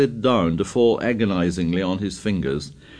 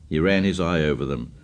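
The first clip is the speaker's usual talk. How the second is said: usual speaking voice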